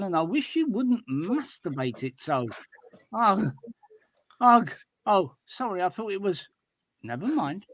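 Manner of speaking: animated reading with acted cries of pain; comic story reading. A narrator's reading. Reader performing a stubbed toe in the cave, animated narration. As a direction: read a comic caveman tale, playing up the grumbles.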